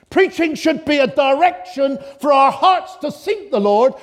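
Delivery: high, loud, impassioned preaching; preaching at full force. Acted. Preacher exhorting his congregation, impassioned full-force preaching. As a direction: drive it home to the congregation like a revival preacher.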